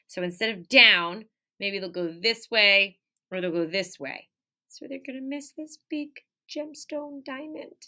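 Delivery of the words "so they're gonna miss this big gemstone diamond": hushed storytime suspense. Acted. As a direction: build the secret for the kids. Suspense for kids.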